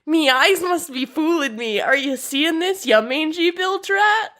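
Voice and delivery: high, exaggerated pirate yell; pirate holler. Shown as a demonstration. Host reading the guide's example of pirate surprise, high mock yell. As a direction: holler it out, stretching the insult at the end. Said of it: "give a holler and yell".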